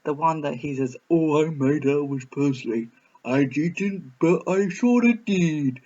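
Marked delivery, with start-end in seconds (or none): 1.1-5.8 mock Tom Hanks accent. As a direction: imitate the manager's odd Southern drawl, half-serious. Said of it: "I had this weird sort of accent".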